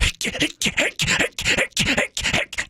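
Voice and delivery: breathy strained grunts; straining hard against the g's; under heavy g force. Retold or imitated.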